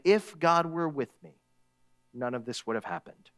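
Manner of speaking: soft, sinking lament; subdued, hurt complaint to God; quiet reproach. Acted. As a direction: let it drop low and quiet. Hurting people turning to God.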